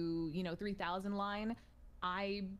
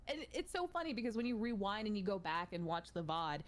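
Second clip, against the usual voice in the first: normal talking voice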